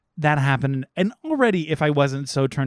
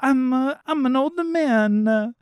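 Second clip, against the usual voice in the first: hammed-up Italian old man; 11 semitones higher, 4 dB louder than usual. Pitching the accent the film should have done, hammed-up Italian old man. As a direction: play Geppetto as a cartoon Italian old man, overdone. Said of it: "just to your point of accent work".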